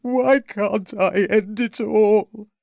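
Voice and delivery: drawn-out despairing cry; distraught youth wailing the question. A narrator's reading. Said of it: "cried the distraught youth".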